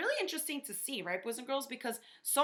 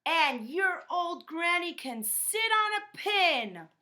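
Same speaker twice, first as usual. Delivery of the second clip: high, loud, slow jeering pig voice; yelled taunt; 8 semitones higher, 6 dB louder, slower than usual. Acted. Pig yelling an insult at the wolf's granny. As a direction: yell the insult down at the wolf. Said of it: "the third little pig yelled".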